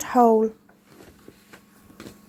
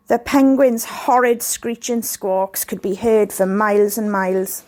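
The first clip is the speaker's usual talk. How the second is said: usual speaking voice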